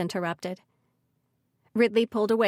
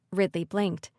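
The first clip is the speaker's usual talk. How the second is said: ordinary delivery, nothing marked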